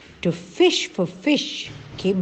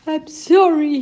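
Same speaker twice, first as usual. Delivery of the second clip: mild sobbing; 8 semitones higher, 6 dB louder than usual. A narrator's reading. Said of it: "he sobbed".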